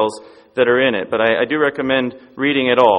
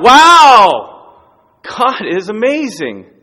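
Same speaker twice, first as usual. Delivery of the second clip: much louder, higher triumphant whoop then slowing; cheering yell; 5 semitones higher, 7 dB louder, much slower than usual. Retold or imitated. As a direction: burst out in delight, then savour it. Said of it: "the kid starts to laugh and cheer and yell".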